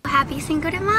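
high, loud party cheer; excited greeting. Real, not acted. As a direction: call it out with excitement. Festive greeting to camera.

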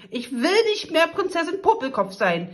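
viel höher und lauter, kreischend vor Wut; schreiend. Acted. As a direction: ein Wutanfall, laut herausgeschrien. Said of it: "Prinzessin Popelkopf schreien". (die Prinzessin schreit ihren Zorn heraus)

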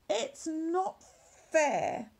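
a light jealous moan. Acted. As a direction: moan like a sulky sheep. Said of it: "moaned Curly".

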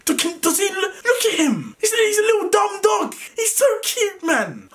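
real tears over his missing dog; crying. Real, not acted. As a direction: voice breaking high, close to tears. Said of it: "I won't say I didn't cry, but yeah, I cried".

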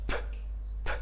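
"پ" as a bare voiceless puff; letter P sound. Shown as a demonstration. Teacher demonstrating the sound of P, whispered puff "پ". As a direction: pop a short "پ" with no vowel. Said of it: "It has the sound of".

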